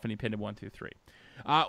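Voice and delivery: ordinary talk, nothing marked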